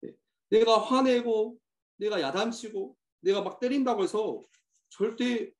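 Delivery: normal talking voice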